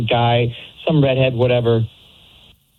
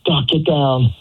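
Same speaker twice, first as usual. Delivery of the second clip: retold panicky yell; 6 semitones higher than usual. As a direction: imitate her urgent outburst in a high voice. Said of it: "she literally yells out".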